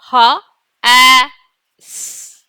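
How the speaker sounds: H, A, S each said apart, with pauses; sounding out letters. Shown as a demonstration. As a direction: say H, A, S one letter at a time. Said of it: "Let's sound it out".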